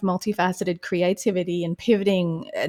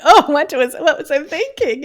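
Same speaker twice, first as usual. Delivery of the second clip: mock cringe at herself; 7 semitones higher, 6 dB louder than usual. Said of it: "I'm like".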